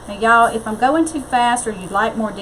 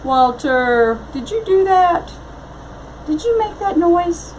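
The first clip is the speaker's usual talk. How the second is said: higher, coaxing voice to a pet; sweet pet talk; 9 semitones higher than usual. Real, not acted. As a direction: coo at your dog. Checking on her pet Walter.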